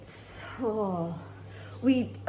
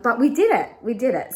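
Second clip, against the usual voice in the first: louder and higher, triumphant; excited cheer; 4 semitones higher, 10 dB louder than usual. Real, not acted. Vlogger cheering to viewers about the paid-off car, excited. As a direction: celebrate it out loud.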